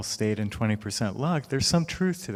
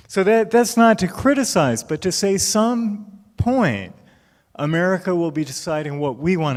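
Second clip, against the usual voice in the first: emphatic raised voice; 6 semitones higher, 5 dB louder than usual. Real, not acted. Emphatic point to audience.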